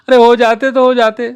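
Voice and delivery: a hammed-up stubborn little girl; defiant little sister. A narrator's reading. Little sister threatening to tell mother, defiant high child voice.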